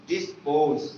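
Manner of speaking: ordinary talk, nothing marked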